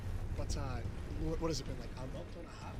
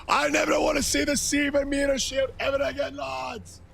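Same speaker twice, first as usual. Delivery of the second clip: re-shouts the line, fired up; shouted repeat; 8 semitones higher than usual. Shown as a demonstration. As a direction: yell it again for the chat. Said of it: "repeat shouted".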